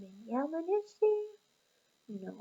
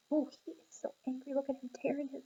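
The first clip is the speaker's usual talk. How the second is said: ordinary delivery, nothing marked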